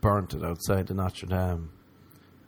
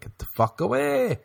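mock disgusted woman; 14 semitones higher than usual. Woman rejecting him again.

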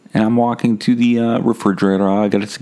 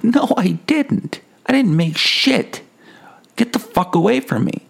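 high-pitched outraged refusal. Retold or imitated. Rebuffing the stranger's claim.

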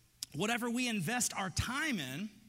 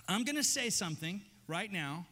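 usual speaking voice

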